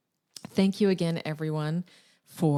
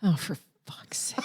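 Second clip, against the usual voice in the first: exasperated whisper; 4 semitones higher, 4 dB quieter than usual. Real, not acted. Groaning at the pun.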